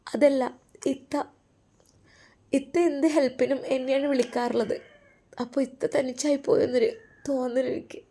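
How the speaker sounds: high-voiced wife, gently troubled. (വിഷമിച്ച ഭാര്യയുടെ ഉയർന്ന സ്വരം)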